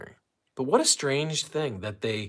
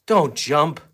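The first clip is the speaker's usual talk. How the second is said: louder, sharper repeated command; firm warning; 3 semitones higher, 7 dB louder than usual. Real, not acted. Owner to dog about to jump, firm warning. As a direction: raise the voice to stop him.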